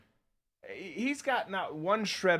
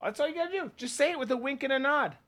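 higher, singled-out mock reassuring line; mock reassurance; 8 semitones higher, faster than usual. Co-host mocking how the border lie is sold, high mock reassurance. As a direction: pitch it up, mockingly easy.

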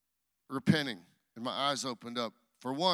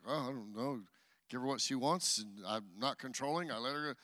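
much softer and lower, embarrassed; sheepish mumble; 4 semitones lower, 7 dB quieter than usual. Retold or imitated. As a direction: shrink away from the question, voice dropping low. Sheepish answer to God's question.

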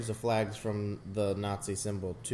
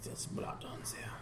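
soft breathy whisper; 15 dB quieter than usual. Real, not acted. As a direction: whisper it to your friend. Whispering at the movie.

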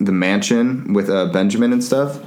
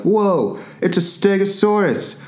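mock dumbfounded; 6 semitones higher than usual. Zia seeing a Stegosaurus, imitated dumbfounded awe. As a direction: marvel in a raised, hammed-up voice. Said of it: "is just, like, dumbfounded".

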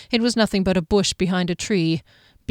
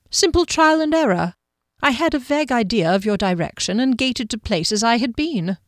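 polite, unruffled guardian voice, higher at the start; smooth guardian; 4 semitones higher than usual. Acted. Guardian explaining how he found the woman, smooth and composed.

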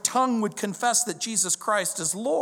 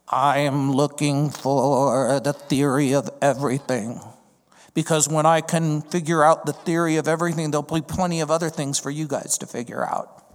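robotic synthesized voice, louder; Stephen Hawking; 4 semitones lower, 4 dB louder than usual. Retold or imitated. Stephen Hawking on TV, robotic synthesized voice. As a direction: imitate a computer speech voice. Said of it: "Stephen Hawking... he goes".

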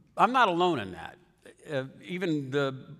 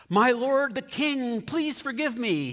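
high, drawn-out mock plea; stretched-out, high-pitched begging; pleading; 6 semitones higher than usual. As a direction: beg for forgiveness, hammed up. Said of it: "he pleaded".

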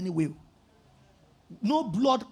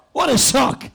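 louder, exclaimed what a shock with falling tune; loud mock shock; 8 dB louder than usual. Shown as a demonstration. Preacher laughing at the copied story, loud exclaimed shock. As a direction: burst out What a shock, hammed-up. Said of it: "Those of you who don't know what a shock is".

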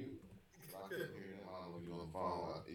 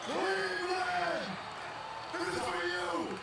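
high, slow, drawn-out hype yell; hype shout; 5 dB louder, much slower than usual. Acted. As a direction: shout it out to the hometown crowd.

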